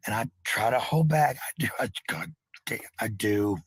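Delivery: ordinary talk, nothing marked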